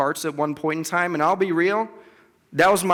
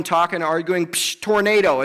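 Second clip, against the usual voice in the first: normal talking voice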